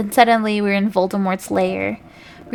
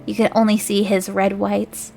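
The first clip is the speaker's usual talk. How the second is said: ordinary delivery, nothing marked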